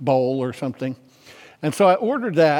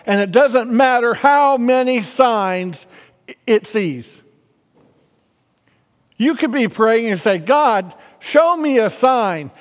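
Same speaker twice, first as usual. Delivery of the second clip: raised, higher-pitched preaching voice; emphatic preaching; 5 semitones higher, 4 dB louder than usual. Real, not acted. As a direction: preach it with rising force and punched key words. Preaches forcefully.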